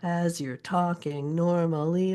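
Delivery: slow, lilting "AS you're TALKing NORmally"; "As you're talking normally" in a slow stressed-unstressed swing; alternating stresses. Shown as a demonstration. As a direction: lean on the accented syllables of "As you're talking normally". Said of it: "I'm alternating stressed and unstressed syllables".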